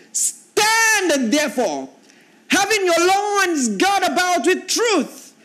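preacher sounding out the armor verses; preaching at full force. Acted. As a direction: stretch each phrase out in a soaring call. Preacher reading verse fourteen.

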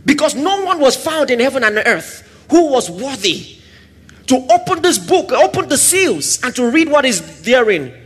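a touch of anguished weeping; lamenting weeping. Retold or imitated. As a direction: grieve over the sealed book. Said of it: "And he says".

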